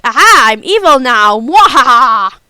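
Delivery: loud hammy villain gloat with cackle; evil villain. Retold or imitated. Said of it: "I was expecting Kaguya to go like".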